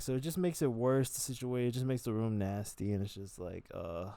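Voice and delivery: usual speaking voice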